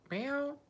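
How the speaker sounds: high squeaky imitation meow to the cat. Calling back to the cat Katana, high squeaky meow. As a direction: mew up high like a kitten.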